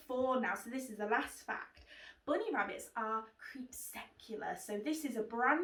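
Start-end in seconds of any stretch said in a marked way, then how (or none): 2.3-4.6 hushed secret whisper; whispering a special word to children. Shown as a demonstration. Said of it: "secret new word whispered".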